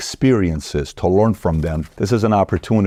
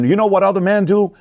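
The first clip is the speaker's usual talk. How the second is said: mocking nagging wife; 11 semitones higher than usual. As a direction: taunt him with other men, pitched up. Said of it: "compare him to other men".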